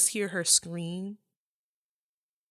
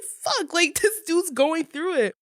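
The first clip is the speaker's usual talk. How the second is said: shocked reaction; 9 semitones higher than usual. Retold or imitated. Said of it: "you're like".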